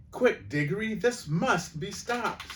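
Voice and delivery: high, urgent cry for action; urgent plea. Acted. Polly to Diggory as the witch attacks, urgent high plea.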